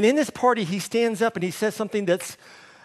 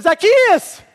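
high, loud call with a laugh; joyful loud call upward; 14 semitones higher, 13 dB louder than usual. Acted. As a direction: shout his name up into the tree, delighted. Jesus calls up into tree.

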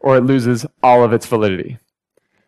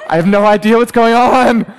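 high, helpless confused whine; hammed-up, higher-pitched confusion; mock bewilderment; 7 semitones higher than usual. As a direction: play up being totally clueless. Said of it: "and I'm just like".

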